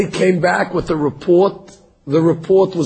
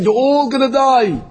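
high, grave pronouncement of doom; God's sentence; 8 semitones higher than usual. Acted. God condemns the generation that maligned the land, high and grave. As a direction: hand down the death sentence like a judge.

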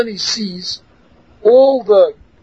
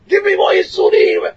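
high shout on waking; screaming; 10 semitones higher than usual. Retold or imitated. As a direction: still yelling as he wakes. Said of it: "he wakes up".

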